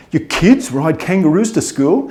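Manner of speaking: incredulous American. Acted. American hearing kids ride kangaroos, incredulous mimicry. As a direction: stretch out the disbelieving question. Gullible American amazed by claim.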